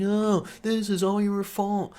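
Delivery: high, slow mock-blaming voice; mock accusing women. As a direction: imitate them whining the blame. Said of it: "they're just yelling at us".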